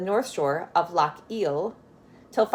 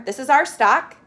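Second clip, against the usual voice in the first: higher, louder, firm claim; proud claim; 6 semitones higher, 7 dB louder than usual. Acted. Grumble tells the policeman the cattle are theirs, firmly. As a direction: stand up for it boldly.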